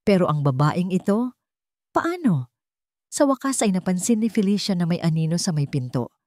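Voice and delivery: ordinary delivery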